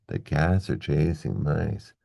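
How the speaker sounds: slow, even beats on "cats", "chasing", "mice"; regular stressed rhythm. Shown as a demonstration. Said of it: "keep a regular rhythm going".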